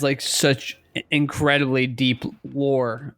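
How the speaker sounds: normal talking voice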